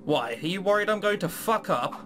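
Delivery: ordinary delivery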